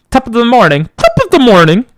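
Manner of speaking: mock Irish-accent channel greeting; Jacksepticeye greeting. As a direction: belt it like an Irish YouTuber intro. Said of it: "Isn't that the YouTuber guy? Jacksepticeye?".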